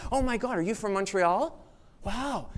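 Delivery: light up at a fellow Montrealer; mock-thrilled hometown greeting; excited recognition. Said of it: "what do you say?".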